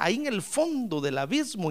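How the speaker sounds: usual speaking voice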